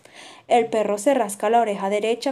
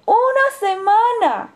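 high, louder, slow mock amazement; exclaiming; 11 semitones higher, 5 dB louder, slower than usual. A narrator's reading. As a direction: gasp it out in mock astonishment. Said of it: "exclama el gorrión".

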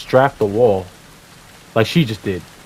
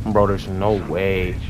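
normal talking voice

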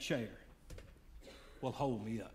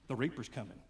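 ordinary talk, nothing marked

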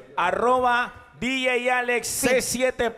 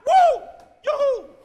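high, loud mock "woohoo" cheers; hinchada fingida; 6 dB louder, slower than usual. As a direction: whoop like an overexcited fan. (gritos agudos de porra)

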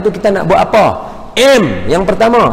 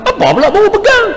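much higher, indignant mock protest.

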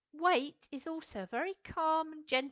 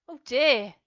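usual speaking voice

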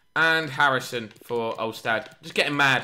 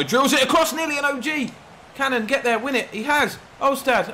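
excited commentary; 12 semitones higher, 5 dB louder than usual. Real, not acted. Attack in stoppage time.

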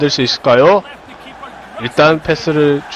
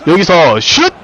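rising, louder goal shout; excited goal call; 5 semitones higher, 3 dB louder than usual. Acted. Goal call in game commentary.

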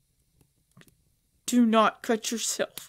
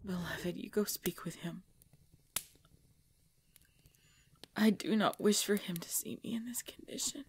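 weak whisper; 8 dB quieter than usual. Acted. Hiding her weakness from guests.

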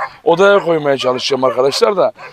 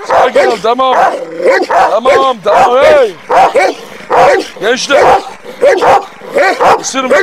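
loud, higher-pitched calls; urgent calling out; 6 semitones higher, 4 dB louder than usual. Real, not acted. Owner breaking up a standoff between animals, urgent calls.

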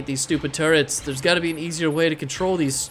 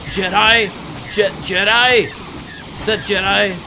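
loud, higher exclamation of surprise; startled disbelief; 5 semitones higher, 5 dB louder, slower than usual. Real, not acted. Player suddenly spotting a Jedi, startled cry. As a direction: blurt it out in astonishment.

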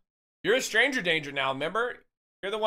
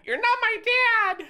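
put-on much higher, slow childish voice; bratty kid voice talking back; squeaky mock whine; 5 dB louder than usual. Shown as a demonstration. Repeat in squeaky voice.

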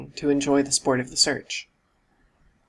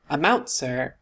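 ordinary delivery

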